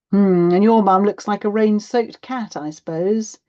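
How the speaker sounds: sarcastic hurt from mum; offended mum. A narrator's reading. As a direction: take it as a personal slight. Mum takes offence at comparison.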